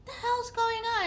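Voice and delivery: high, alarmed question; startled. Retold or imitated. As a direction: jump at the strange noise. Startled by cackling.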